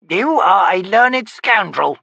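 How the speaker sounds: high, slow crone voice; old witch. Acted. Witch grumbling at her visitor, high crone voice. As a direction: croak it like a cranky hag.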